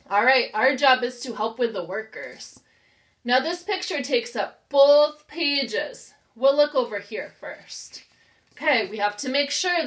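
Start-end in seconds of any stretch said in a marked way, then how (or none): none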